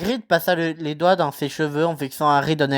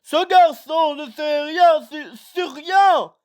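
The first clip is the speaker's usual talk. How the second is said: roaring giant, pitch climbing high, slow; 11 semitones higher, much slower than usual. Acted. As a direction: roar it in outrage. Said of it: "rugit Hagrid".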